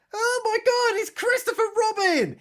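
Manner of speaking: high shrieking mock gasp of shock. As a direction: squeal it like a horror victim spotting the body. Mock horror at finding Christopher.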